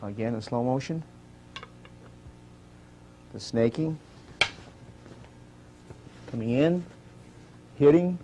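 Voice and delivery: name each move softly as it happens. Acted. Quiet slow-motion move call-outs.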